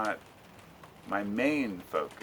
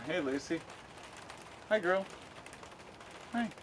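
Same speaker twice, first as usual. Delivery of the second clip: talking to a dog; 9 semitones higher, 6 dB quieter than usual. Real, not acted. Greeting his dog Lucy.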